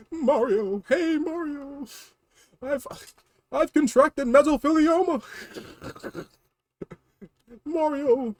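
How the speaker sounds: high, pleading little-brother voice with laughs; whiny Luigi. Acted. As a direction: squeal for Mario's help. Luigi voice, silly complaints.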